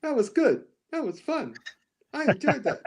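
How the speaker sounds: high, bright gushing over the film; thrilled moviegoer gushing; gushing happy moviegoer. Retold or imitated. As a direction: gush like a thrilled fan leaving the theater. Mimics himself leaving theater happy.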